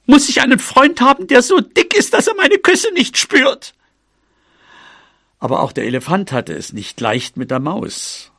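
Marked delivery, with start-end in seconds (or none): 0.1-3.7 very high squeaky mouse complaint, climbing higher; exasperated cry. A narrator's reading. Mouse complaining her elephant friend feels no kisses, high exasperated cry. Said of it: "rief sie".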